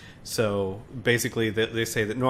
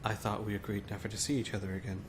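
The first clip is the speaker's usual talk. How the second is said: quick, softer nervous dock worker; 4 semitones lower, 10 dB quieter, faster than usual. A narrator's reading. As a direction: hurry the words out, anxious to be rid of him. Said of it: "He looks at you nervously".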